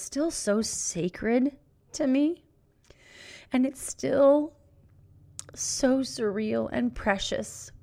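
slow, voice catching with real emotion; holding back tears while speaking slowly; emotional. Real, not acted. Host tells listeners about a sacred moment, tearful and slow. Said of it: "I'm starting getting so emotional".

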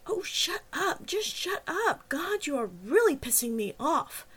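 high, slow, furious snap at him; angry snapping. A narrator's reading. Jenny snapping at Wagner to stop teasing, angry. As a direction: cut him off, fed up and angry. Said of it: "I was so mad I could hardly see straight".

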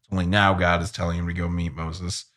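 ordinary talk

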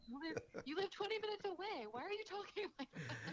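high, mock-shocked questioning; retelling her shocked reaction, pitched high; incredulous disbelief. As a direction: exaggerate your disbelief at the neighbors' accent. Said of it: "And I'm like".

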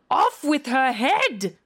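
high, drawn-out acted shout. A narrator's reading. Queen ordering Alice's execution, theatrical shout. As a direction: proclaim it imperiously, stretching every word. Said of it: "the queen shouted at the top of her voice".

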